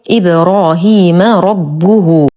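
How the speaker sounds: إبراهيم ربه slowly, "ibrahima rabbuhu"; correct case endings. Shown as a demonstration. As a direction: open the mim, round the ba in إبراهيم ربه. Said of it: "الميم فيه مفتوحة". (إبراهيم ربه بفتح الميم وضم الباء)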